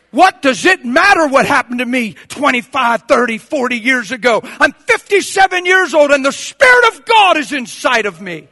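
loud, high, emphatic sermon delivery; full-force preaching. Real, not acted.

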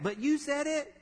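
put-on high-pitched kid talking back; protesting child. Child answering back to the scolding parent, high-voiced protest.

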